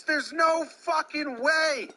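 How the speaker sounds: loud, high, slowed outraged yell at the umpire; furious shout. Acted. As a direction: yell it, stretching every word. Mock player rage at umpire.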